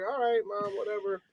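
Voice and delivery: put-on dismissive child. Child giving in to mother's novela rule, quiet dismissive voice. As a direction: give in to mom under your breath. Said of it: "you'd be like".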